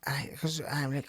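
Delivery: ordinary talk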